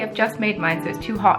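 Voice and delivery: normal talking voice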